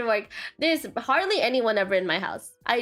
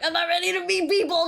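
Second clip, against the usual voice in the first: higher-pitched, mildly hammed-up dread; mock social panic; 7 semitones higher than usual. Streamer acts out facing surprise guests, mock panic. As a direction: protest as if caught off guard. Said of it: "I get really, like".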